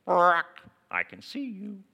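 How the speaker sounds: sudden loud then high, strange bird voice; parakeet voice. Acted. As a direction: spook the thief like a talking bird. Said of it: "the thief hears".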